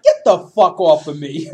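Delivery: loud mock-angry outburst; loud, indignant shove-off; angry command. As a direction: bark it as a retold order.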